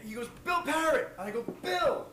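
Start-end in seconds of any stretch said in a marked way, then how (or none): 0.4-1.1 calling out. Retold or imitated. Old man announces himself to the puzzled singer, calling out. 1.6-2.1 calling out. Retold or imitated. Singer plays along with the old man, calling out.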